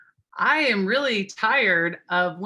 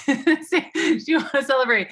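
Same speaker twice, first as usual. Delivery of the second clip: laughing; 6 semitones higher, 5 dB louder than usual. Real, not acted. Laughing invite to celebrate.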